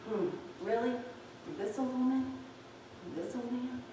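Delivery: soft, skeptical murmur; doubting inner voice. Retold or imitated. Preacher voicing a listener's soft, doubtful inner murmur. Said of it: "you're still thinking".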